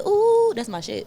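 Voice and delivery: drawn-out high ooh; high, slow delighted ooh; excited reaction. Retold or imitated. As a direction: react like your song just came on. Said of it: "I said".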